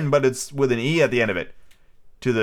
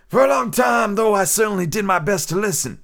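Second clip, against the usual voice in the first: mistaken pirate voice; 9 semitones higher, 4 dB louder than usual. Acted. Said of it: "Oh, no".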